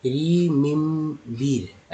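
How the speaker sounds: drawn-out "ri-mim-beer"; every E in remember read as "ee"; slow, wrong "ee" vowels in remember; mispronounced remember. Shown as a demonstration. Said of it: "जो कि गलत हो जाता है".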